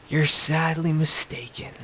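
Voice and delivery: emphatic scornful verdict; pointed put-down. Real, not acted. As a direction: spell it out slowly to Rob. Scolding Rob, said twice.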